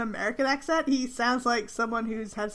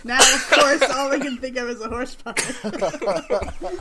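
real laughter breaking through, pitched up; laughing while talking; 2 semitones higher, 5 dB louder, slower than usual. Real, not acted. Friend cracking up over the horse puppet idea, laughing as they talk. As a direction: talk through a fit of giggles.

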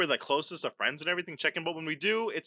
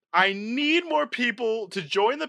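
mock fury; 6 semitones higher, 4 dB louder, slower than usual. Mock rage continues.

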